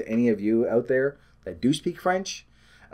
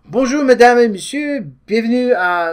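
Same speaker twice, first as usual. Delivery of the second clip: high, announcer-style French greeting; mock French host opening the show; French show intro; 9 semitones higher, 6 dB louder than usual. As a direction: welcome the audience like a French host. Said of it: "we can start this way".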